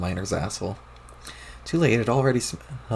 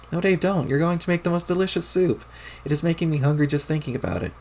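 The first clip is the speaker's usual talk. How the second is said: put-on higher, eager troll girl begging; high Terezi voice; 8 semitones higher than usual. Terezi begs Dave not to ruin the soup, high and eager. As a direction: plead high and bright over the soup.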